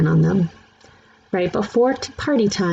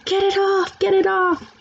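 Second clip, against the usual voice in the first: put-on much higher, frantic little-kid voice; screaming child; 13 semitones higher than usual. A narrator's reading. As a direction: shriek it like a scared toddler. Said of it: "Sam screamed".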